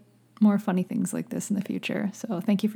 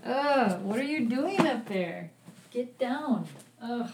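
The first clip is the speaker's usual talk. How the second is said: higher, softer telling-off; real fond exasperation; exasperated scolding; 4 semitones higher, 8 dB quieter than usual. Real, not acted. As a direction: fuss at a pet from across the room. Scolding pet away from mic.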